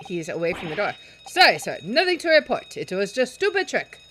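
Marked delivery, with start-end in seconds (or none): 1.3-4.0 gruff sailor yelling. Acted. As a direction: bellow at the intruders like an angry deckhand. Sailor reporting to officer.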